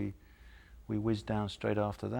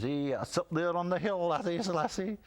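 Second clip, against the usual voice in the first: higher, hammed-up Scottish accent; mock Scottish greenkeeper; 9 semitones higher than usual. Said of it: "And he says".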